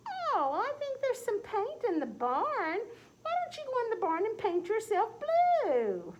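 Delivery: high, slow, catty drawl; cat character voice. Acted. Cat teasing the turkey about his color, high slow catty voice. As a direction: stretch it out like a sly cat.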